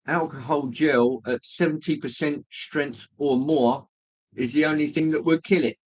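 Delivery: ordinary delivery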